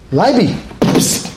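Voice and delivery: high, slow coaxing "Leibi, psst"; coaxing call. Retold or imitated. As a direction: sweet-talk the lion closer. Trainer coaxing the lion.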